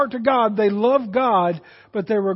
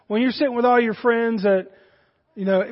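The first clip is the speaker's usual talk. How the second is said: ordinary delivery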